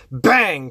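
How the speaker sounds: high loud vocal bang; gunshot sound. Acted. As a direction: spit out the shots. Gunshot effect.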